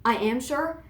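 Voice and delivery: ordinary delivery, nothing marked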